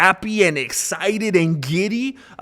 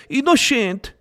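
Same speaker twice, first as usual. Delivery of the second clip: Innocent said as ee-no-shant in a higher Spanish accent; 7 semitones higher, slower than usual. Shown as a demonstration. Joking about the defendant's heritage, in a Spanish accent. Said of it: "I'm talking Spanish".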